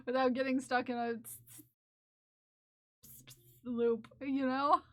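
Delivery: normal talking voice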